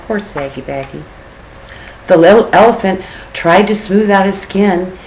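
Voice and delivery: ordinary delivery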